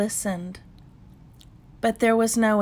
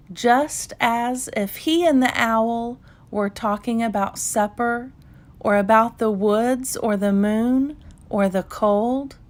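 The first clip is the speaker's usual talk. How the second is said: ordinary delivery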